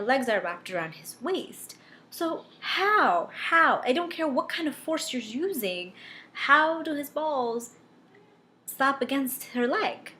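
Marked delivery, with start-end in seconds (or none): 2.1-5.2 incredulous. Real, not acted. Incredulous rant about logistics. 6.3-10.1 slow, high, drawn-out incredulous question; slow mocking disbelief; exasperated disbelief. Real, not acted. Incredulous rant about logistics.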